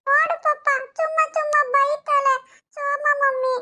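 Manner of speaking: put-on high, slow whining little girl; whiny toddler. Daughter complaining to papa, high slow toddler whine. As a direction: drag it out like a sulking two-year-old.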